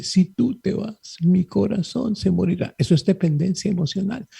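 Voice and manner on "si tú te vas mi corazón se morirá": merengue lyric lament. Retold or imitated. Priest quoting a merengue lyric, hammed-up heartbroken lament. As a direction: croon the lyric like a heartbroken ballad. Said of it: "como dice el merengue".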